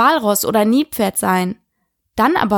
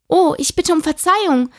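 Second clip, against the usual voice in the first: higher-pitched, alarmed apology; startled apology; 7 semitones higher than usual. A narrator's reading. Alice to the mouse she has hurt, startled apology. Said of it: "rief Alice schnell erschrocken".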